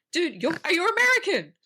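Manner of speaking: high-pitched, exasperated disbelief; incredulous outburst. Retold or imitated. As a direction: react in mock outrage, voice jumping up high. Reacting incredulously to film's casting.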